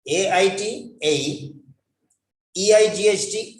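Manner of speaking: normal talking voice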